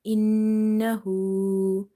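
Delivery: innahu very slow with held nasal nn; innahu, stretched nasal nn; nasal ghunna. Shown as a demonstration. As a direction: hum the nn of innahu for one alif. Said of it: "we will stretch up to one alif".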